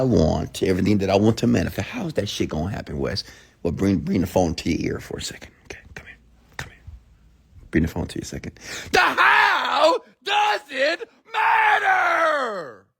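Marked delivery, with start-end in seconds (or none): 3.6-6.8 secretive whisper into the phone; conspiratorial whisper. Acted. Asks to bring phone close. 8.9-12.9 drawn-out screaming yell, very high; shout. Acted. Prank shout into phone ear.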